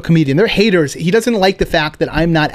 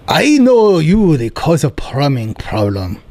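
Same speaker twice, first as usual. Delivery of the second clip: put-on Korean landlord accent; slower than usual. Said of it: "he goes".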